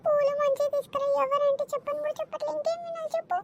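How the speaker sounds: put-on irritated old auntie; peevish auntie. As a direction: cut the girl off impatiently. Auntie snapping at the guest.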